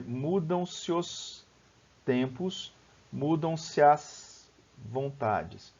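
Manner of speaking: slow verse, "se os" and "se as" each fused into one syllable; vowels run together. Shown as a demonstration. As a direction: glide "se os" and "se as" together, drawn out. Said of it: "eu vou dizer".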